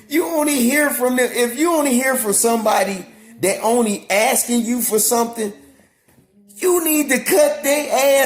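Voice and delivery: high, loud impassioned rant; heated shouting. Real, not acted. Vlogger warning viewers about users, heated high-pitched shouting. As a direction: raise the pitch and volume, hammering the point home.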